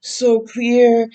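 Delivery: real tears welling as she reads; moved reader fighting tears; tearful. Real, not acted.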